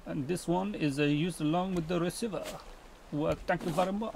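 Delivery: put-on French accent; playful, unexplained French accent. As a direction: read it out in a mock French accent. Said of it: "why I put on a French accent".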